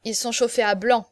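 ordinary delivery

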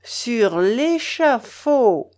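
"l'échafaud" very slow, every syllable leaned on; menacing stress on each separate syllable of "l'échafaud"; syllable-by-syllable emphasis. A narrator's reading. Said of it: "repartit-il en appuyant sur chaque syllabe du mot".

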